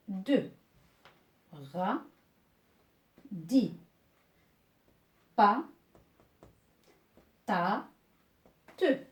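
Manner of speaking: isolated syllables from Ro to ta, pauses between; syllable-by-syllable reading. Shown as a demonstration. Teacher reading syllables for learners to repeat, one by one. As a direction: read each syllable singly, leaving room to repeat. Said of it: "lisez après moi".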